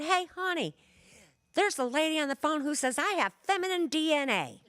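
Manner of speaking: calling out high to his wife in the next room; a mock holler across the house, pitched high; retold holler. Nephew calling to his wife, a mild high holler.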